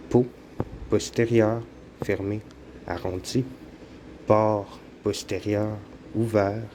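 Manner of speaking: ordinary talk, nothing marked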